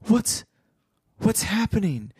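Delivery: weak voice. Acted. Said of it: "he heard someone say in a weak voice".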